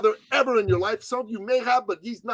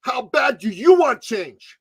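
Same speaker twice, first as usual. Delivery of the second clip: challenging shout; 2 semitones higher, 5 dB louder, slower than usual. Real, not acted. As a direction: hit them with it like a coach. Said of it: "here's what I'm gonna ask you".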